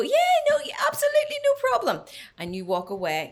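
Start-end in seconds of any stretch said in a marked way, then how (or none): 0.0-2.0 put-on very high, loud, gushing English accent; hopeless English accent. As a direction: squeal it out in a bad posh English accent. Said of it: "That was my impression of Fiona, hopeless English accent".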